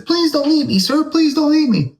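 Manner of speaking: very high, pleading chicken voice; squeaky chicken. Acted. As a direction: beg like a tiny terrified chicken. Scared chicken voiced in story.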